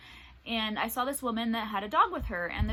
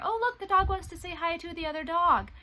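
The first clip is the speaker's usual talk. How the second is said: higher, cooing mock voice of an oblivious bystander; mock clueless employee; 8 semitones higher, 4 dB louder than usual. Walmart employees watching the dogs, mock sweet cooing. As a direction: play the gawking staff as sweetly clueless.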